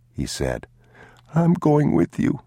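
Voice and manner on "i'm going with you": put-on high, small child's voice; a scared young boy tugging at his father; boy's voice. A narrator's reading.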